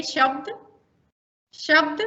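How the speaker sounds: शब्द with a crisp palatal श; palatal sh drill. Shown as a demonstration. Teacher drilling शब्द with trainees, crisp palatal श. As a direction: model शब्द for repetition. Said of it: "मैं एक शब्द बोल रही हूँ कि शब्द".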